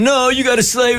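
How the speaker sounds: squeaky imitation of a needy husband begging; mock clingy husband. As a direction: whine it up high like a husband who won't let her go. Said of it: "him going".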